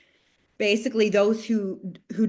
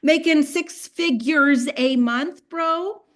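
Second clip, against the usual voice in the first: higher, disbelieving mock-defensive "bro"; incredulous retort; 6 semitones higher than usual. She scoffs back at Nam, higher and incredulous. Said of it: "I was like".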